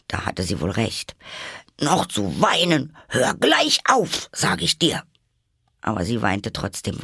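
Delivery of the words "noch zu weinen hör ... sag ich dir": stern order. A narrator's reading. Alice ordering herself to stop crying, very high and loud. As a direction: order yourself to stop now.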